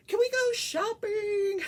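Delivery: high, loud, drawn-out begging; hyper teen granddaughter. Retold or imitated. Granddaughter begging Grandma to take her shopping, hyper. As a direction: imitate an overexcited teen's squeal. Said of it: "She's very hyper".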